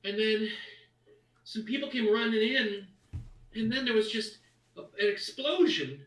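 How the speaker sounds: put-on high, loud little boy voice; frightened child. The halfling boy telling Bertha about the blast, high and frightened. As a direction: blurt out what happened, still scared.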